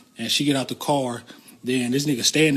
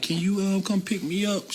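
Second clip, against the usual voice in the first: imitating crying girl; 7 semitones higher than usual. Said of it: "She crying and shit".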